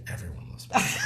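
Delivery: giggling through the words; whispered laugh while backpedaling. Real, not acted. Teasing take-back after joke.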